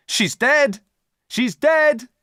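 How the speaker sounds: high, loud shout; crowd shouting. A narrator's reading. Crowd outside the prison shouting, loud and high. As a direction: cry it out like a crowd. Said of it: "The crowd of people shouted".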